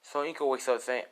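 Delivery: ordinary delivery, nothing marked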